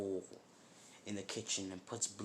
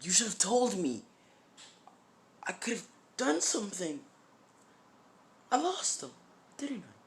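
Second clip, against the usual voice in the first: high, loud accusation; upset, high-pitched protest; anguished outburst; 10 semitones higher, 6 dB louder than usual. Acted. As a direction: cry out in reproach, dropping off at the end. Naruto reproaches master over his loss.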